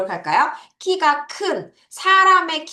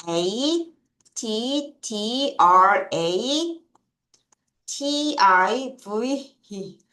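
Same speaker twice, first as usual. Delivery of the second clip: letter by letter, slow; slow spelling for the class; much slower than usual. Shown as a demonstration. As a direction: spell it out for students. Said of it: "매력적인이란 표현 쓰겠습니다".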